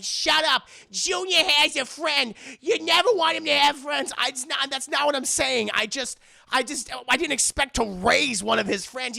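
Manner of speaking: hammed-up marital spat; mock angry snap. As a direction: tear into the husband. Parents bickering.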